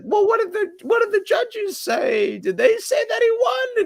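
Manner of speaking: high-pitched indignant retelling; mad retold quote. Retelling to friends how Jay challenged the judges' verdict, mad. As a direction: imitate an outraged, high-voiced demand. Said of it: "so mad".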